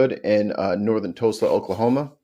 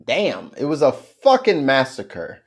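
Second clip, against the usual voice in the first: usual speaking voice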